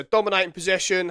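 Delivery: ordinary talk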